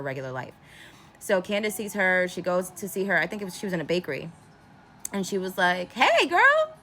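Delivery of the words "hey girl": high, loud sing-out greeting; friendly greeting. Retold or imitated. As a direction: call out to a girlfriend, pitched way up. Voices friend greeting Van.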